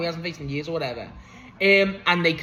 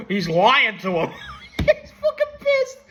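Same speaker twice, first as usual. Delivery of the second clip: high, slow words squeezed through laughter; helpless laughter; 8 semitones higher, slower than usual. Real, not acted. Reviewer retelling Schiavone's commentary, helpless laughter. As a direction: crack up while getting the words out.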